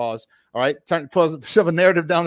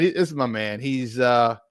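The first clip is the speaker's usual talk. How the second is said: normal talking voice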